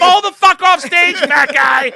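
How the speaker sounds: high, loud laughing yell; heckler yelling. Retold or imitated. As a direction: scream it at the stage, laughing. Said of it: "the woman goes".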